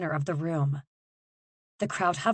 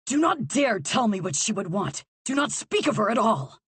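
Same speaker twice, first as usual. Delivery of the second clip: rising venom, louder and higher; venomous; 6 semitones higher, 4 dB louder than usual. Acted. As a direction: spit each warning harder. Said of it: "the venom in his words".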